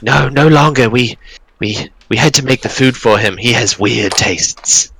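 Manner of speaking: a goblin voice, high at first, sinking toward normal; goblin voice fading. Acted. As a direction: goblins gossiping about their strange master. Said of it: "They're like".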